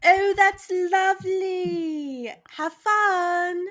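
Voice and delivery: high, slow patronizing voice; patronizing lady. Retold or imitated. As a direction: talk down like a sweet owner. Imitating Cat Lady.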